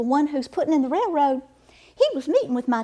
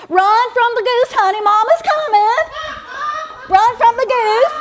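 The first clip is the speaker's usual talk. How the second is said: put-on calling out to child; 11 semitones higher, 8 dB louder than usual. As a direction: shout after the little girl.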